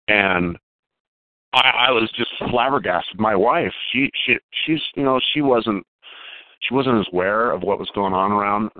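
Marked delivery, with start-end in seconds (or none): none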